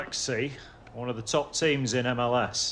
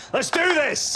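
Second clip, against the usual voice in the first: rallying cry; 12 semitones higher than usual. Acted. Coach's pep talk climax.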